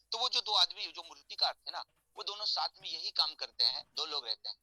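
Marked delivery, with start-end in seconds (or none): none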